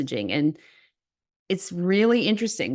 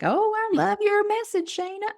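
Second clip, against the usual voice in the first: put-on a colleague teasing in a Southern accent; mock Southern accent. Colleague mimicking her in a high Southern accent. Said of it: "in a Southern, mimicking me".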